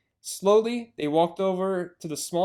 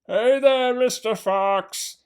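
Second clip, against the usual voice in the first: higher, louder, drawn-out taunt; taunting yell; 6 semitones higher, 6 dB louder, much slower than usual. Acted. Bunce leaning from his tractor, taunting yell. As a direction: call down mockingly. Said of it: "yelled Bunce".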